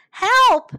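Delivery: high, very loud yell; shriek-like call for rescue; crying for help. A narrator's reading. Field mice to all as developers arrive, crying out. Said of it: "cried the field mice".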